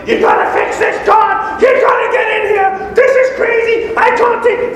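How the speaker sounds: high, loud, desperate mock shout at God; frantic pleading to God. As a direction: panic and demand that God fix it now. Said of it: "and we're like".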